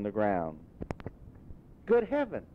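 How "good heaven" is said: much higher, startled exclamation; old man stunned by a childhood place; amazed cry. A narrator's reading. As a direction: gasp it out in wonder. Scrooge sees his boyhood home.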